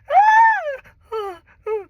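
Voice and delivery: sharp high cry of labor pain. Retold or imitated. Mother in labor crying out at the hospital, pained cry. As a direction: cry out through a contraction. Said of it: "and I'm like".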